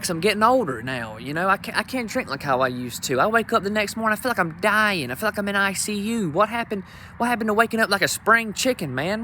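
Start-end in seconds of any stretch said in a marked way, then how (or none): none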